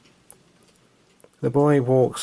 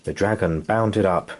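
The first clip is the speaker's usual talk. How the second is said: ordinary delivery, nothing marked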